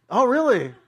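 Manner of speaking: high, loud, eager questioning; nosy young driver. Retold or imitated. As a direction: imitate the driver pouncing with interest. Driver's dreaded follow-up question, acted.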